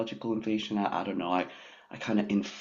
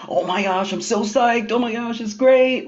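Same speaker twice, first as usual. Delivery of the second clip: much higher, much louder hammed-up delight; mock gushing excitement; 10 dB louder than usual. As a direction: parody her own inflated joy. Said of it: "you know, it's like".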